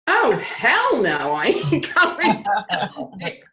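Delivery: high, comic protest with a laugh; incredulous refusal. Retold or imitated. Woman arguing with the voice she hears, comic incredulous refusal.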